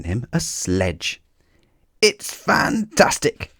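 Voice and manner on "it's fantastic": high, slow, breathless delight; excited cry. A narrator's reading. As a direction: gasp it out in wonder. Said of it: "cried Mister Wrong".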